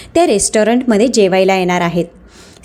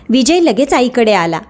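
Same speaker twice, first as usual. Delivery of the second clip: usual speaking voice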